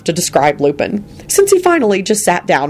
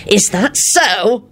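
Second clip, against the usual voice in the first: high, drawn-out angry challenge; shouted; 6 semitones higher, much slower than usual. A narrator's reading. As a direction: throw it back slowly and angrily. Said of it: "Lupin shouted".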